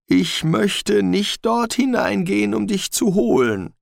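high, nervous little dog; a jittery mongrel pleading; talking dog voice. Acted. Frightened dog speaks.

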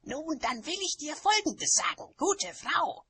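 high, loud, indignant witch voice; loud high-pitched rebuke; screeching witch. A narrator's reading. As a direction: tell the other off at high pitch. Quarrel escalates after the screech.

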